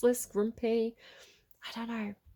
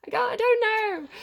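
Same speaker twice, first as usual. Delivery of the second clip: high, loud, giggly giving up; laughing helplessly; 12 semitones higher, 5 dB louder than usual. Real, not acted. Reader giving up on her French guess, laughing helplessly. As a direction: throw it out high through laughter.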